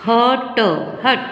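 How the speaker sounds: ordinary delivery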